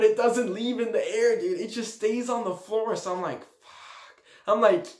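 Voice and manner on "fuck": barely voiced, very soft curse; whispered dread. Sees the firework stuck on the floor, whispered dread. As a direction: whisper it under your breath in dread.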